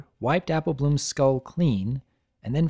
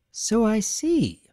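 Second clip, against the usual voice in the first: skeptical lady voice; 8 semitones higher, slower than usual. Acted. Rarity, skeptical.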